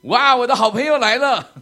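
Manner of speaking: loud, high, delighted hello to a friend; excited greeting. Retold or imitated. 对孩子兴奋大喊，好朋友来了. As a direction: greet a friend with a big happy cheer. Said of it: "我就会说".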